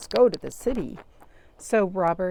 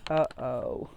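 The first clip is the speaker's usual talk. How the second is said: uh-oh; 6 semitones lower than usual. Acted. Trouble coming again.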